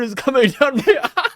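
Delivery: cracking up mid-sentence; a fast giggle breaks through the line; laughing. Pretend. Mock sad scene, breaks laughing.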